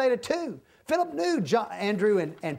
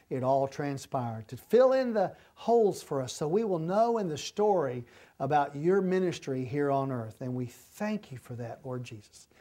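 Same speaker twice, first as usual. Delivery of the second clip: closing prayer; 4 semitones lower than usual. Real, not acted. Thanking the Father as the chapter study ends, prayerful.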